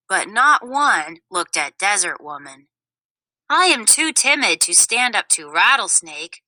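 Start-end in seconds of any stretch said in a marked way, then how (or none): none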